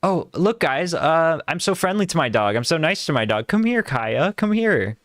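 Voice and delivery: hammed-up, sugary calling of the dog; mock friendly owner. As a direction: overdo the nice-guy act for the camera. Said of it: "So he's like".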